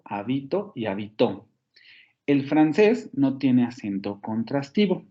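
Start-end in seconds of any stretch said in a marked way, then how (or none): 0.0-0.7 «habito» con golpe en BI; acento en la sílaba media. Shown as a demonstration. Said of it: "la posición del acento". 0.9-1.5 «habitó» con golpe en TÓ; acento en la última sílaba. Shown as a demonstration. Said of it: "la posición del acento".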